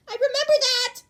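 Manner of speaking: very high, loud eager cry; excited shout. Acted. Uraraka remembering the fridge, excited. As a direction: blurt it out delighted.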